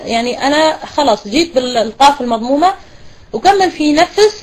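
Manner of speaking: ordinary talk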